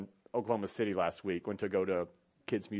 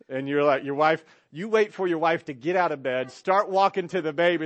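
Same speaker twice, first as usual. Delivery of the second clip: normal talking voice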